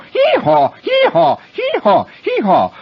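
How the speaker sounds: loud, high hee-haws, stepping down in pitch; donkey braying. Retold or imitated. Boy launching into his donkey scene, loud high braying. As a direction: bray repeatedly like a donkey. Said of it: "So I go".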